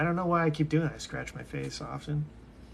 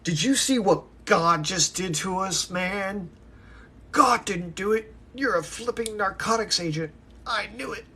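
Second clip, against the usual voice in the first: loud, high-pitched accusation; worked-up outburst; 6 semitones higher, 6 dB louder than usual. Acted. Accusing a man of being a narcotics agent, loud and worked up. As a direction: shout it out, pitched well above usual. Acted outburst, scene from memory.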